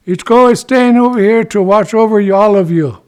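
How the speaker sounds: high, loud chief's proclamation; loud announcement. Acted. Chief announces Ichiko's watch to the camp, loudly proclaiming. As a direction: announce it to a whole crowd.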